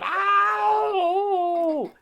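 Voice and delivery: long, high, loud cartoon howl of pain; wolf's pained howl. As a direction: howl "ow" like a hurt wolf. Wolf howls, shot in the eye.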